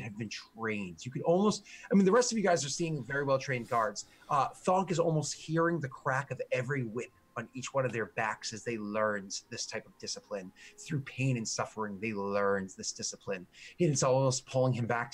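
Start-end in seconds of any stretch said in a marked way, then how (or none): none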